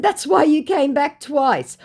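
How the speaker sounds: high, slow, smug put-down; snooty high voice. Retold or imitated. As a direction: lay it on with lofty scorn. Her own retort, acted.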